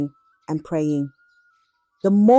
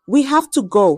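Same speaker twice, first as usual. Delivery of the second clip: trembling voice; 5 semitones higher, 5 dB louder than usual. A narrator's reading. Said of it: "his voice trembling".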